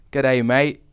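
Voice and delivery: put-on G'day, mate in a broad, loud Australian accent; strong Australian accent. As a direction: lay on a thick Australian twang, loud. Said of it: "More like that".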